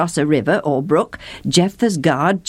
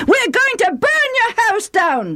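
high, shrill-pitched yelled threat; screaming threat. A narrator's reading. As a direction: scream it at the enemy. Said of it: "the Ephraimites screamed".

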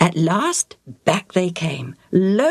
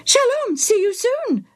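very high parrot mimicry; Bobo the parrot. Parrot repeats the priest's goodbye, squawking. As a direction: squawk the farewell back like a parrot. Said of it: "the bird repeated".

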